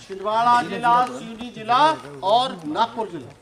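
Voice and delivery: loud, measured classroom announcement, slowing at the end; loud dictation. Real, not acted. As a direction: project each district name loudly to the whole class. Teacher dictates answer to class.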